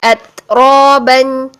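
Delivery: अतरबन very slowly, breath released after the त; drawn-out अतरबन with an airy त; breathy अतरबन. Shown as a demonstration. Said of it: "सांस को जारी किया". (अतरबन में सांस जारी)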